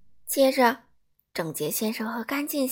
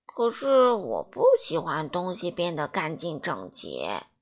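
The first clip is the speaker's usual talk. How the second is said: much lower and slow, grumbling; Mr. Messy's reluctant voice; 6 semitones lower than usual. A narrator's reading. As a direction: drag it out, not wanting to be tidied. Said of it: "邋遢先生回答说". (低沉缓慢、不情愿的邋遢先生)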